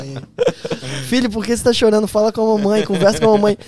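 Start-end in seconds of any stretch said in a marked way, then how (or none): 1.1-3.6 high, loud, insistent mum voice; hammed-up pleading mum; imitated mother. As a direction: mimic a mother begging her son to talk. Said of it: "Aí minha mãe assim".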